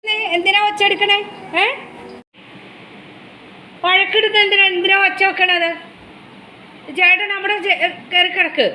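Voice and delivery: high-pitched, indulgent scolding; real high-voiced chiding of a beloved animal; chiding a pet. Real, not acted. Questioning noisy pet about its fussing.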